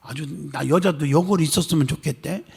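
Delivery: normal talking voice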